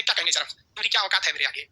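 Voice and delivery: as if humbly brushing off the question; a quietly modest voice; calm humble reply. Retold or imitated. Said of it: "आराम से कहेंगे".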